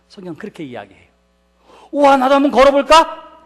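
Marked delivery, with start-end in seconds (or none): 1.9-3.4 louder, higher, carried away on a whim; mock impulsive excitement. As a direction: play it like a rash thrill-seeker. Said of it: "감정적으로 혹은 무식하게 뛰어내린". (충동적으로 들뜬 흉내)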